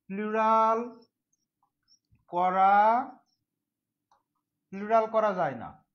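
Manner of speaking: drawn-out dictation for note-takers; slow dictation. Shown as a demonstration. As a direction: stretch each word, pausing between. Dictating for students to write.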